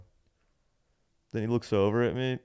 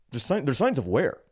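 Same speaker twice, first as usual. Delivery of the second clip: high, louder mock clerk voice; doubting clerk; 5 semitones higher, 5 dB louder than usual. Clerk inspecting returned shirt, mock doubtful. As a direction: mimic a hesitant clerk, pitched up.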